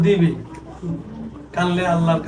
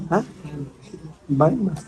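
ordinary talk

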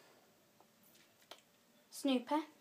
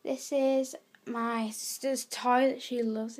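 usual speaking voice

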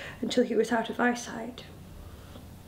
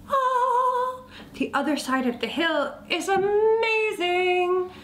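high, drawn-out singsong rapture; dreamy sing-song bird voice; gushing robin; 8 semitones higher, slower than usual. A narrator's reading. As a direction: swoon over it, stretching the vowels high. Miss Robin gushes about other side.

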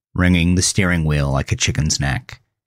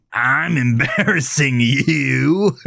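mocking; 6 semitones higher, slower than usual. A narrator's reading. Melvin mocks Gavin.

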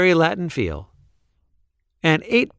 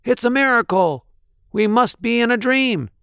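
high, astonished crowd cry; amazed shout; 11 semitones higher than usual. A narrator's reading. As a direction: gasp it out in wonder. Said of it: "They shouted".

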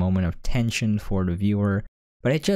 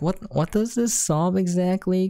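higher, baffled retort; incredulous; 9 semitones higher than usual. Retold or imitated. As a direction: ask it like the plan makes no sense. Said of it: "And I'm like".

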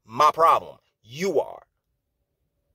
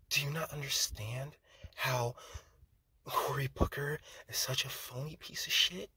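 mocking Cory Booker; 6 dB quieter than usual. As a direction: parody his soft, airy emotional delivery. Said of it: "I can do it too, guys".

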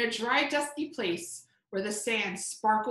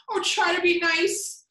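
whimpering; 5 semitones higher, 4 dB louder than usual. Acted. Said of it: "he whimpered".

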